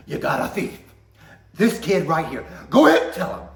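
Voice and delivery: rising to a furious shout; angry mom building. Retold or imitated. Mom exposing thief to manager, building to a shout. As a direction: build from firm to yelling. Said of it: "When she's angry, everybody knows".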